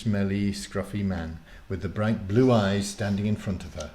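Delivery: ordinary delivery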